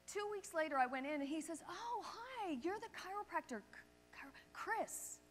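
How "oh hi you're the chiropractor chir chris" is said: high, soft greeting of someone remembered; delighted recognition. Retold or imitated. Pet store manager to returning customer, pleased recognition. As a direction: greet a familiar customer in a higher voice.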